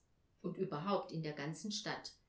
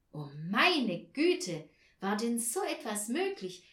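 astonished exclamation; 2 semitones higher, 7 dB louder than usual. A narrator's reading. Narrator reacts to Olaf producing the key, astonished exclamation. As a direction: stretch it out in loud disbelief.

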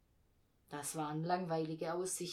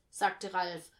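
plain reading voice

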